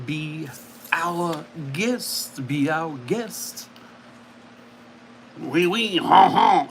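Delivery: slow, hammed-up French accent. Giving viewers a Beauty and the Beast clue, French accent. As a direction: put on a cartoon French host's accent. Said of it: "I should not have done the accent".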